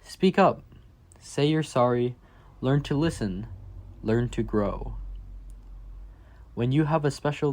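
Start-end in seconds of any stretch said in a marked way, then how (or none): none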